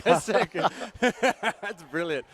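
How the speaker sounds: real laughter through the words; laughing. Real, not acted. Commentator laughing at his partner's accent, delighted. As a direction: laugh out loud while praising.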